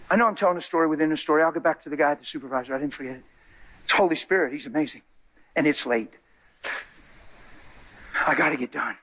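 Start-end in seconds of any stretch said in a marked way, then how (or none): none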